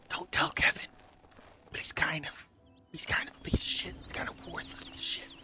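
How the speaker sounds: hushed secret aside; whispering. Real, not acted. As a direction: whisper it behind his back. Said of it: "Don't tell him I said that".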